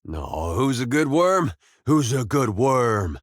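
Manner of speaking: slow, swooping pet-praise voice; doting baby talk. Acted. Man praising his worm, doting baby talk. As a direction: coo at it like a beloved pet.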